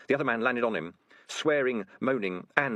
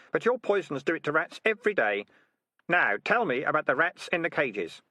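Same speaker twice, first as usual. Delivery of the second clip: lightly voiced stern Keith figure, a little higher; stern young interrogator; 6 semitones higher than usual. Keith confronting a ratcatcher over cruelty, mildly stern. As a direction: press the accusation steadily.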